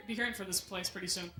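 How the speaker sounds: normal talking voice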